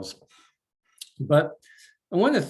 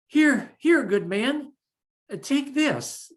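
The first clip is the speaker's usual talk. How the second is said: kindly giving; 11 semitones higher, 4 dB louder than usual. Acted. Bridget gives the sword away.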